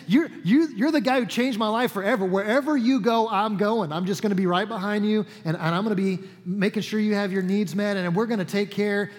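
a freed woman eagerly pledging to follow Jesus, excited; excited devotion. Retold or imitated. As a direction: gush it like a grateful follower. Retold Mary pledges to follow.

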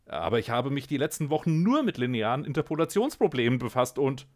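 high, loud and fast objection; protesting. Acted. The scientist protests to the cat, fast and loud. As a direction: rush the protest before being cut off.

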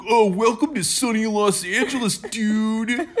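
high, exaggerated dude greeting; imitating surfer dude. Copying her partner's impression, mock surfer dude. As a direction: stretch the oh like a dopey teen. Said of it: "You're like".

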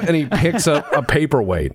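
ordinary talk

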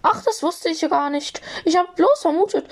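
high squeaky little voice; Flitty. A narrator's reading. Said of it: "entschuldigte er sich quiekend".

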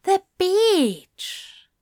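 excited realization. A narrator's reading. Zira realizes.

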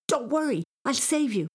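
put-on high, urgent boyish cry; screamed. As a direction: call out a rescue in panic. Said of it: "he screamed".

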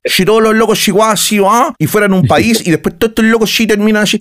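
high, sing-out street slang voice; mock a rough Chilean convict's slurred talk; high-pitched put-on slang with sh for ch; popular-class Chilean caricature.

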